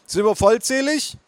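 high-pitched service voice; waitress. Acted. Waitress checking on the party, service voice. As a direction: sing out to the table.

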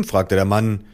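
ordinary delivery

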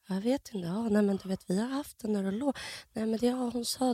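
overheard murmuring. Retold or imitated. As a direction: talk softly, as if overheard from nearby. Imitating staff overheard talking nearby. (dämpat, härmat prat bakom väggen)

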